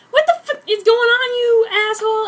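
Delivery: loud, high hammed-up screech; mock scream. Narrator bursting in on Edward, high hammed-up scream. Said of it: "I screamed".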